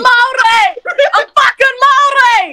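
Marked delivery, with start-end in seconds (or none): 1.1-2.5 high, loud, drawn-out declaration; indignant shout. Real, not acted. Insulted caller tells streamer what they really are, indignant shout. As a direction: shout it slowly to correct them. Insists on identity in quarrel.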